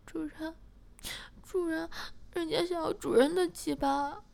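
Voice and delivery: high, tearful pleading whine; aggrieved whine. Acted. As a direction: plead like she's been wronged. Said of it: "诗雅委屈地说".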